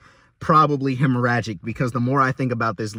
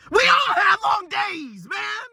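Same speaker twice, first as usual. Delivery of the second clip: exasperated shout; slower than usual. Real, not acted. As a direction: yell it at the ceiling, fed up. Exasperated at customer excuse.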